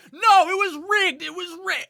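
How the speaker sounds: outraged full shout, high and very loud; crowd member protesting. Acted. Angry bettor in the crowd cries foul, high loud shouting. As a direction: yell it out over a cheering crowd. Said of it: "you hear someone say".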